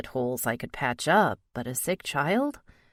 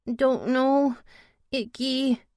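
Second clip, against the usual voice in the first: put-on sick little boy; 9 semitones higher, 3 dB louder, slower than usual.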